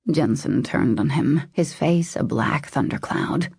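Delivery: ordinary delivery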